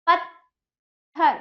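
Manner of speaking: पत्थर slowly, pausing after पत्; syllables split. Shown as a demonstration. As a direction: break पत्थर in two, light half त before थर. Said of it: "ता में हल्की आवाज आ रही है".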